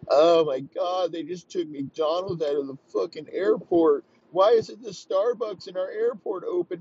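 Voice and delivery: high, whiny mock panic, dragged out at first; whiny Putin. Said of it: "He's like".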